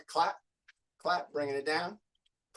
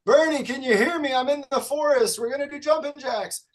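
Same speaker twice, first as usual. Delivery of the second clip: calling out; 9 semitones higher, 7 dB louder than usual. Real, not acted. Calling out to absent student.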